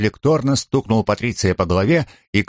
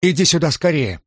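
urgent call; 7 semitones higher than usual. A narrator's reading. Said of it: "громко позвала Фабиола".

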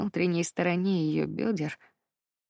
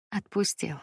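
ordinary delivery, nothing marked

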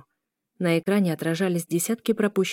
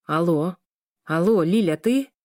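irritated phone greeting. A narrator's reading. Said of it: "Даня спрашивал раздражённо".

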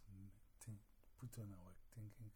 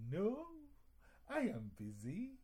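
loud, much higher "No, I am busy."; high loud refusal as Anansi; busy spider; 12 semitones higher, 11 dB louder, slower than usual. Acted. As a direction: squeal it like a cranky spider. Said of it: "And Anansi says".

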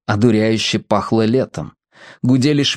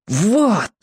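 lucky treasure hunter; 8 semitones higher than usual. A narrator's reading. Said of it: "голосом удачливого кладоискателя".

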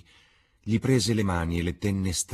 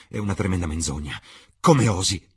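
fast, then a loud furious flare; angry outburst; 3 semitones higher, 7 dB louder than usual. A narrator's reading. Dorian turns on Lord Henry in angry disbelief. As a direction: hurl the accusation back at him. Dorian rejects the terrible news.

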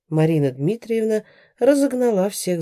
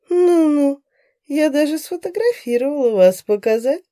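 much higher, louder smug jeer; gloating; 9 semitones higher, 5 dB louder than usual. A narrator's reading. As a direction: crow over her triumphantly. Raisa gloating.

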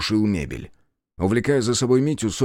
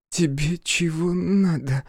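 higher, slow, exhaled words; breathed question; 8 semitones higher, slower than usual. Acted. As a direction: barely breathe it out. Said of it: "выдохнула Сонька".

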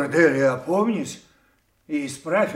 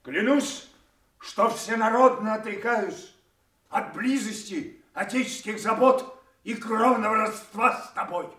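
higher, rising fury as he swears; a king casting off his daughter, raised and furious; solemn angry oath; 6 semitones higher than usual. Acted. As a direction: swear the oath, voice climbing with rage.

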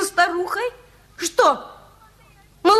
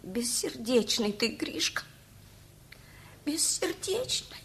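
softer, barely voiced reproach; hurt whisper; 6 semitones lower, 14 dB quieter than usual. Old mother reproaching the cat alone.

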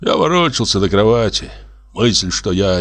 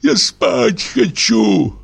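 indignant shout; 10 semitones higher, slower than usual. A narrator's reading. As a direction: insist hotly on sleep. Quarrel through the wall.

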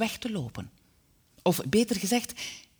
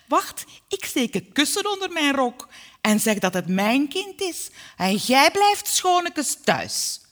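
loud, high-pitched gloating; triumphant mother laying down the law; scheming mother; 8 semitones higher, 6 dB louder than usual. A narrator's reading. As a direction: announce the scheme with domineering relish. Mother triumphantly announces her scheme.

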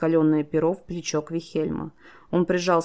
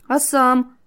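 high, loud panicked question; startled, panicky young heroine; 8 semitones higher, 6 dB louder than usual. A narrator's reading. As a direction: ask it in alarm, voice shooting up. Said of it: "в панике спросила она".

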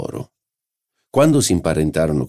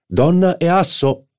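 much higher, slow, ringing announcement; triumphant; 13 semitones higher, 4 dB louder than usual. A narrator's reading. Morel winning the card game, triumphant cry. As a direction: crow it out as the winning play. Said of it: "annunciò trionfalmente Morel".

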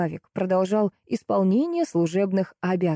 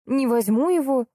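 higher-pitched petulant protest; stubborn refusal; 7 semitones higher than usual. A narrator's reading. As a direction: refuse as if stamping a foot. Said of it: "топнула я". (упрямый протест)

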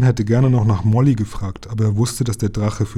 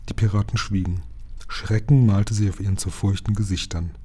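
ordinary delivery

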